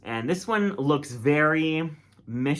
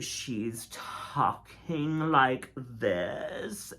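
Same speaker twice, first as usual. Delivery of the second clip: put-on drawn-out, very slow Valley Girl drawl; Valley Girl accent; 6 dB quieter, much slower than usual. Reviewer mimics the queen playing Gretchen, slow Valley Girl accent. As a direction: imitate an over-the-top Valley Girl, stretching every word. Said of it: "saying everything in this Valley Girl accent".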